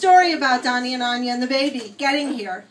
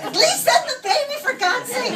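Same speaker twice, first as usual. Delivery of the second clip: high-pitched, comically exasperated begging; exasperated mock plea; 8 semitones higher than usual. Mother begging for the baby at least, mock exasperated. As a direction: plead it up high, half laughing.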